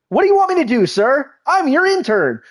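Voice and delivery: high, chirpy mock-subservient voice; hammed-up servile pep; mock eager underling. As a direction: play an over-eager intern reporting to the boss. Acts an absurdly eager intern.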